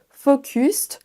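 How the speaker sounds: focused as fo-KUST with French O and U; French vowels. Shown as a demonstration. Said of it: "le O comme un O français". (focused avec un O et un U français)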